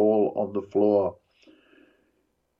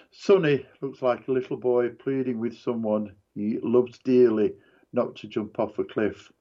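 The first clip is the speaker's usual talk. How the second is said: plain reading voice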